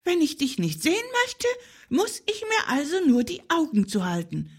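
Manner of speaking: high, fast animal chatter; little hare voice. Acted. Little hare working out invisibility, high-voiced. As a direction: reason it out quickly in a high voice. Hare works out the squirrel's trick.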